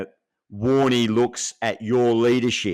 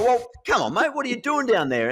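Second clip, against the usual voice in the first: much higher, cheeky goading voice; mock Warne taunt; 13 semitones higher than usual. Mocking a batter as Warne, pitched high and teasing. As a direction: needle the batter like Warne at the crease. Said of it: "and then Warney go".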